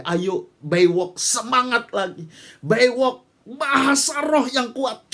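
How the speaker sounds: rallying shout. Real, not acted. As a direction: fire up the youth, shouting. Rallying the youth in prayer. (seruan lantang membakar semangat pemuda)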